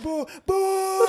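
high loud mock boos; crowd booing. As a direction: boo like a whole hostile crowd.

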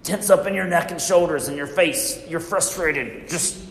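the amygdala barking orders at the body, urgent then hushed; amygdala's voice. Retold or imitated. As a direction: voice the brain's panic signal, pressing and tense. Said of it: "sends all these messages to your body that says".

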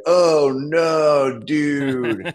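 put-on loud, drawn-out drunk dude; drunk guy. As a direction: put on a drunk dude dismayed at his mistake. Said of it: "that was just a drunk guy".

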